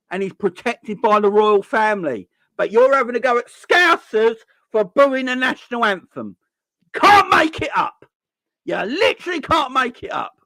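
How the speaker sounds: high, loud, incredulous outburst; outraged rant. Real, not acted. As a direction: voice climbing in disbelief and anger. Ranting about booing Scousers.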